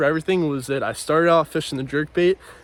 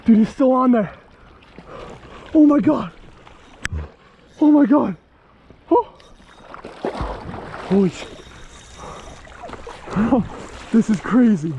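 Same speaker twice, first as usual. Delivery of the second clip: excited; 6 semitones higher than usual. Real, not acted. Hooked huge trout, yelling.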